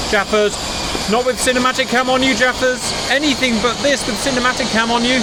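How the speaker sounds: high-pitched cries of dismay at his wingman; pleading up high with a friend crashing on camera. Real, not acted. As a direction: wail as your mate wrecks the shot.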